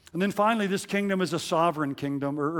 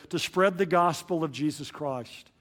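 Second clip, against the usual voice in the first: ordinary delivery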